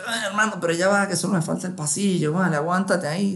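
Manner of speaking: high pleading plea to hold on; pleading urgency. Retold or imitated. Begging his own body to wait, high pleading. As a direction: beg your body to wait a bit.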